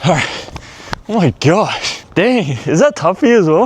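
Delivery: panting, pitch jumping up; winded, still catching breath. Real, not acted. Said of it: "I'm, like, running out of breath".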